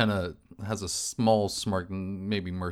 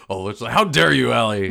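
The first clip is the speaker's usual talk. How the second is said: mock outrage; 3 semitones higher, 9 dB louder than usual.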